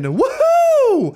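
very high, loud mock cheer; hammed-up hooray; sarcastic cheer. Real, not acted. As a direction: fake celebration of bad news. Must wait until midnight again.

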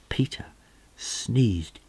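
plain reading voice

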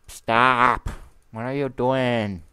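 high, loud shout of alarm. Real, not acted. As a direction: yell at the attacker. Enderman attacks.

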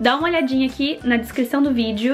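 upbeat sponsor read. Acted. Sponsor read before the vlog.

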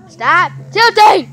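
a loud, slow shout at a sibling; loud yell. Real, not acted. Kid yelling slowly at her brother to stop tilting the phone. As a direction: bark it out slowly and loudly.

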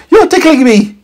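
high, scolding squeak; indignant meteorite. A narrator's reading. Meteorite scolds scientist, high indignant. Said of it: "said the meteorite".

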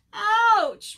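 high, loud "ouch" moan; hurt little bird moaning loudly; moaning in pain. A narrator's reading. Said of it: "moaned Bird".

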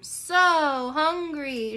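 slow drawn-out hungry moan.

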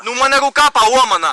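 very loud, pained insistence; shouted protest. Real, not acted. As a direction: shout it to stop them cuffing you. Protests handcuffing with broken arm.

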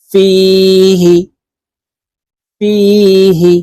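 ফিহি with the long ি held one beat; one-alif stretch. Shown as a demonstration. Teacher showing the one-alif stretch in ফিহি. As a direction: stretch the vowel in ফিহি a single count. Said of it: "এক আলিফ টানিয়া পড়িতে হয়".

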